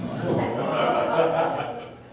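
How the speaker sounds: puppet laugh. Acted. Puppet laughs at own joke.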